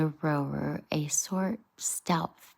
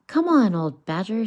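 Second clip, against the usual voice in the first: louder call across the bank; shouted call; 6 semitones higher, 10 dB louder than usual. Acted. Rat calls out loudly to Badger in the hedge. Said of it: "shouted Rat".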